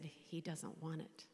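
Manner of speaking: soft refusal. Retold or imitated. Answering nurses at deathbed.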